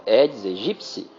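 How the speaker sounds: "Aedes aegypti" said slowly, "aegypti" ending in a clear psi sound; correct pronunciation. Shown as a demonstration. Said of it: "que a pronúncia correta é essa". (pronúncia correta, devagar: "Aedes aegypti" com som de psi)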